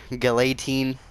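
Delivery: Galatine with a "lay" in the middle; rejected pronunciation. Shown as a demonstration. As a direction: say Galatine as "guh-LAY-teen". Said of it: "I don't say it like".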